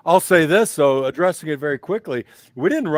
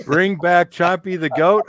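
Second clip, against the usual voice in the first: mock plea; 4 semitones higher, 7 dB louder than usual. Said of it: "a message from Q forty-two's creator".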